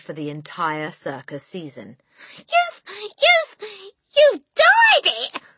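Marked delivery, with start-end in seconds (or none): 2.4-5.4 very high, halting shriek; shrieked stammer. A narrator's reading. Mother accusing husband, stammering shriek. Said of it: "shrieked the mother".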